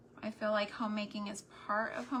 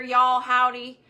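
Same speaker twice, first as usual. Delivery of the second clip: loud, playful Texas twang; demonstrating Texan greetings; Texas accent; 2 semitones higher, 13 dB louder, slower than usual. Shown as a demonstration. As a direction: show off a Texas accent, louder. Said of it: "that's why you'll hear".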